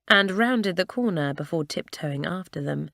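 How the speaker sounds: ordinary delivery, nothing marked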